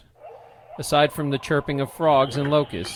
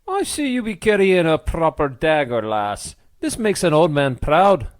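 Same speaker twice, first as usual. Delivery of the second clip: Scottish brogue; 4 semitones higher, 6 dB louder than usual. A narrator's reading. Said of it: "with the Scottish brogue".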